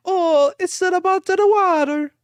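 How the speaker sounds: very high, hammy Italian accent. Shown as a demonstration. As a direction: sing out a mock Italian accent. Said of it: "That's Italian".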